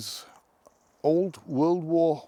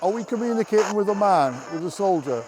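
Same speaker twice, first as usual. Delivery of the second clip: higher-pitched projected question; calling out; 5 semitones higher than usual. Real, not acted. Ghost hunter asks who is communicating, calling out. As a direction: call the question out clearly.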